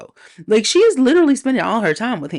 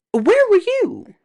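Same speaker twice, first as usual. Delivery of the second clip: exasperated demand; 4 dB louder than usual. Real, not acted. Scolding Magneto.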